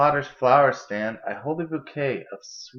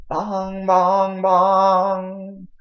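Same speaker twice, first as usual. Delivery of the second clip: long ringing bongs, each louder and higher; bell imitation; 6 semitones higher, 7 dB louder, much slower than usual. Narrator imitates the park bell ringing, long booming bongs. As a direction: ring them out like a big old bell.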